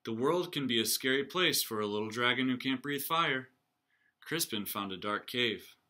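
ordinary delivery, nothing marked

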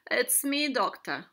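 loud, mildly moaned greeting; hurting octopus, a loud moan. A narrator's reading. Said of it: "Octopus moaned".

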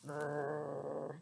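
low, soft growl; grumpy bear growling at the bunny. Acted. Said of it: "says Bruce".

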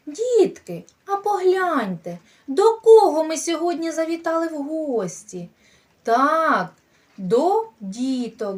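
bright, sing-song voice for little ones; preschool teacher voice. Acted. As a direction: coax the children in. Teacher talking to small children.

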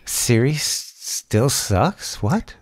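soft sarcastic disbelief, rising at the end; mock-incredulous sarcasm. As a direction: feign quiet astonishment, drawing out the question. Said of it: "There's the good sarcasm".

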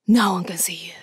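hushed stealth whisper. Shown as a demonstration. Soldier whispering stealth orders to a comrade. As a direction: whisper so nobody hears.

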